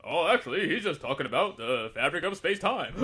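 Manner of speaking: smug Neil deGrasse Tyson. Retold or imitated. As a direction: play the smug science guy. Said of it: "Neil deGrasse Tyson comes in and says".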